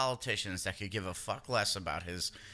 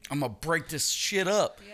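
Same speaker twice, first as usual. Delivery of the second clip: tough-guy voice; 6 semitones higher, 4 dB louder than usual. Acted. Mock-tough declaration.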